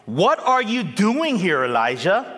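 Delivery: high, loud, grand reading as the voice of God; booming voice of God. Acted. Preacher reads God's question to Elijah, booming and grand. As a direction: proclaim it like God speaking from above. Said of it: "He says in verse nine".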